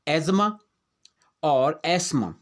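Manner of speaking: एजमा and एस्मा, th silent; correct pronunciation. Shown as a demonstration. Teacher giving students the right way, th silent. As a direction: say एजमा, then एस्मा, no t sound. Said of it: "ये है".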